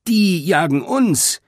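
high, drawn-out warning; alarmed cry. A narrator's reading. Fleeing beaver revealing they are chased, crying in alarm. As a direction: cry it out in alarm, stretching the words.

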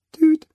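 kleines, helles Hupen wie ein Spielzeugauto; tüte ganz hoch und kurz; hohes Tüten. A narrator's reading. Tiny horn sound. (hohes Hupgeräusch)

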